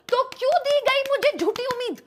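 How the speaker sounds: hammed-up betrayal at fate; mock outraged cry. Comic outburst of mock outrage. (नकली गुस्से में ऊँची चीख)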